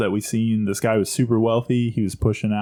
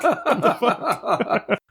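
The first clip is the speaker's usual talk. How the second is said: high, slow laughing what the fuck; laughing mock outrage, high and drawn out; laughing disbelief; 12 semitones higher, much slower than usual. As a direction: squeal it out through laughter. Laughing retelling.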